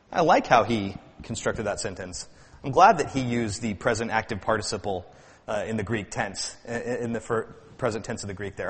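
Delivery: low, soft musing connoisseur; detached appreciation. Acted. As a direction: quietly play the scholar admiring a fine point. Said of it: "something to sit back and say".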